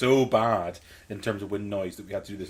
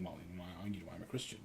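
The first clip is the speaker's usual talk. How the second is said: very soft, low apologetic murmur; hushed, sheepish admission, imitated; almost apologetic; 4 semitones lower, 16 dB quieter, faster than usual. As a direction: say it small and low, as if excusing yourself. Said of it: "she was almost apologetic about it".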